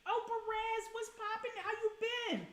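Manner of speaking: high, bright-toned call to a viewer; excited high greeting. Real, not acted. Streamer welcoming a viewer back, high excited greeting.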